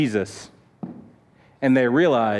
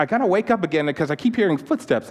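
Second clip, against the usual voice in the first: usual speaking voice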